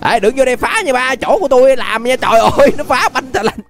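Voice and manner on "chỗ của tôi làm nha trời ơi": ordinary talk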